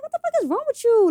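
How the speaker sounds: high-pitched, exasperated retold question. Girlfriend retelling her question to her sulking boyfriend, exasperated.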